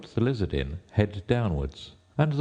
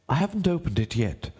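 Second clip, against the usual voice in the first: high, deferential courtier; 11 semitones higher than usual. A narrator's reading. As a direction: address the king in a high rabbit voice.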